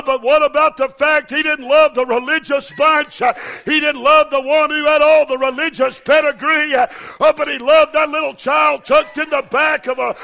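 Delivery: loud, high, rising preacher's shout; whooping Southern preacher, loud and high; preaching at full force. Acted. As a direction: build the sermon to a fervent climax.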